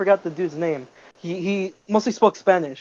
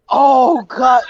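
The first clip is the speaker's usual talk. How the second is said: higher, louder retold gasp of shock; shocked exclaim; 6 semitones higher, 5 dB louder than usual. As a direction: blurt it out as the blood appears. Retold shock at seeing blood.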